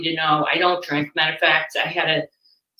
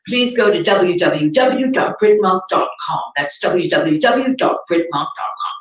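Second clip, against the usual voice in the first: British meditation voice. Retold or imitated. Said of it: "a British guided meditation".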